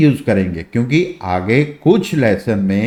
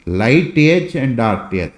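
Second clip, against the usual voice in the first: slow teaching demo; much slower than usual. Shown as a demonstration. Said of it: "इन दोनों में क्या फ़र्क होता है".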